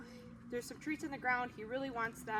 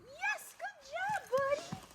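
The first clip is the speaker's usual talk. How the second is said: high excited praise; much slower than usual. Real, not acted. Praising dog after release.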